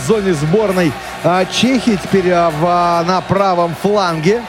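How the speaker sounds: normal talking voice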